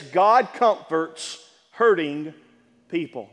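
each word of "God comforts hurting people" laid down slowly; slow, weighty statement. Shown as a demonstration. Pastor states his point to the congregation, slow and weighty. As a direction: spell the statement out slowly for the church. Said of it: "Let's say this".

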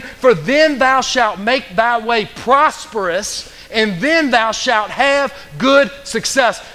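fervent, driving pulpit delivery; full-force preaching. Real, not acted. Preacher urging the congregation out of the wilderness, fervent preaching. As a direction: preach it hard, punching key words.

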